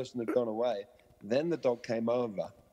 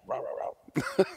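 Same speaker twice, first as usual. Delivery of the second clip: rough mock barks; spoken dog-bark impersonation; imitated barking; 8 semitones higher, slower than usual. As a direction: bark along like a worked-up dog. Owners' shouting heard as barking.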